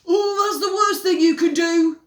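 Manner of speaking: very loud alarmed shout. Acted. Henry stopping Peter from asking Mum, loud shout. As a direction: stop him with a yell. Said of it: "shouted Henry".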